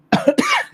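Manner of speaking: sudden sharp choking cough. Real, not acted. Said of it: "I'm choking on my saliva".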